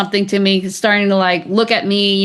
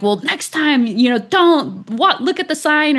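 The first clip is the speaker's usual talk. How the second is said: higher, mildly mock-annoyed parting shot; parting scold; 6 semitones higher than usual. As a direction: toss off his irritated last word in a lifted voice. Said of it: "So he's getting angry at me".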